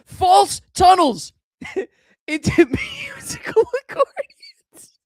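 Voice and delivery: wheezing laugh through words; can barely get the words out laughing. Real, not acted. Repeats quote cracking up.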